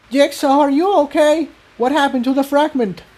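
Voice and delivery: put-on high-pitched worried young pony; Tiptoe voice. A narrator's reading.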